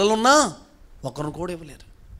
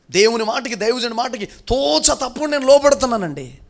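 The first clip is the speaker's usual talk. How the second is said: louder, higher pulpit call; rousing preaching; 5 semitones higher, 7 dB louder than usual. Acted. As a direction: push the congregation to declare it. Preacher rallies the congregation. (ఉత్సాహంగా ప్రసంగం)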